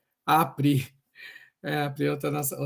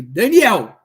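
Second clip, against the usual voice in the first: calling out; 2 semitones higher, 12 dB louder than usual. Retold or imitated. Calling out for colleague.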